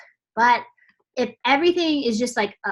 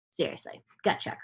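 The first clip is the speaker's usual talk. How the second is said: whispered aside; 5 semitones lower, 10 dB quieter than usual. Real, not acted. Gut check aside to viewers.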